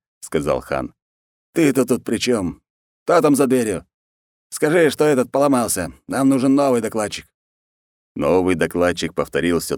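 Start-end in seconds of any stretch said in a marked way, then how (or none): 1.5-7.3 high-pitched impatient ruler; exasperated khan. Acted. Khan demands a new reporter, high exasperated voice. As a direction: order them about, pitch raised.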